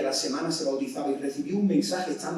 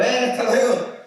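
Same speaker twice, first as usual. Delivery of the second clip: higher, louder cheeky goodbye; mock cheeky shout; 9 semitones higher, 7 dB louder than usual. Preacher acts defying a guard, mock shout. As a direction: shout it off as you speed by.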